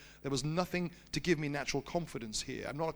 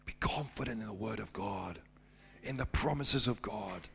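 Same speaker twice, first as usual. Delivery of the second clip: sermon appeal; 4 semitones lower than usual. Acted. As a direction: drive the point home to the church. Applies story to church.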